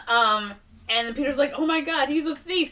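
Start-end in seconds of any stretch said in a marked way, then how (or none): 1.5-2.7 mock alarm. Peter discovers the luggage is stolen.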